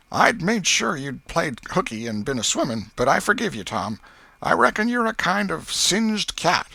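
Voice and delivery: a disappointed aunt giving in; aunt grudgingly forgiving her nephew; Aunt Polly. A narrator's reading.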